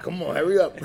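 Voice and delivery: high, loud comic urging; mock impatience. Dog owner hurrying the dog along, mock impatient. Acts his impatient former self.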